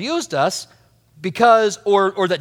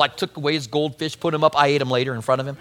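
usual speaking voice

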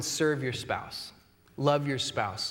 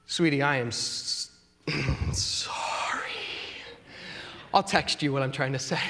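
mock struggling apology; 4 semitones lower than usual. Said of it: "the words that are so difficult to come out".

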